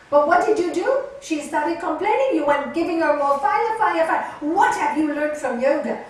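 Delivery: loud, high, relentless scolding; guru telling-off. Retold or imitated. Guru scolds Nalini for feeding the quarrel, loud and forceful. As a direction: imitate a guru firing accusing questions. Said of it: "the one who's told off".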